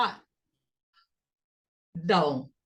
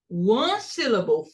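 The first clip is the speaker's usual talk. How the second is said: usual speaking voice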